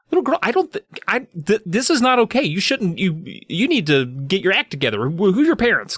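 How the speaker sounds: lightly voiced scolding Karen; mock Karen. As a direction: stumble over the words as a hammed-up Karen. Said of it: "getting real Karen with her".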